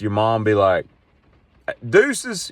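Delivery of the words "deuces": breezy mom farewell. Pretend. Mother snake leaving her young.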